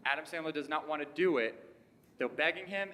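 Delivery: ordinary delivery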